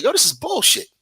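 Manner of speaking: disgusted returnee. Retold or imitated. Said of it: "you'll come back and be like".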